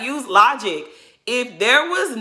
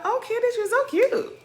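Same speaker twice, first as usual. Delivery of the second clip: much higher, sing-song fake gush; mocking fake-sweet coo. Mocking Robin gushing at Candace, much higher. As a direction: squeal it like a two-faced friend.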